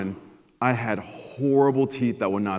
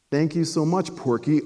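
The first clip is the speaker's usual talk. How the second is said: teacher voice; 6 semitones higher, 3 dB louder than usual. Retold or imitated. Teacher mocking stutter nickname.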